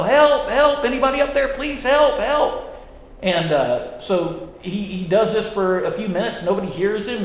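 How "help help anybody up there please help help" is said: yelling for help. Retold or imitated. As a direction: call up the cliff, mildly hammed-up. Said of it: "he starts yelling to anybody up above".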